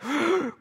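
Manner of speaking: sharp high mock gasp; startled stage gasp. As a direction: gasp as if catching a slip. Mock alarm at a slip.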